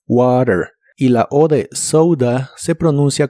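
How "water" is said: "water" con t como r; flapped t. Shown as a demonstration. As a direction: tap the t of "water" like r. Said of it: "La T de water ... como R".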